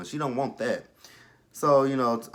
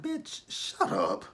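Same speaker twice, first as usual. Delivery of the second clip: much higher, very slow "bitch, shut up"; sassy brush-off; much slower than usual. Pretend. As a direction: stretch it out high with contempt. Dismissing a TV character.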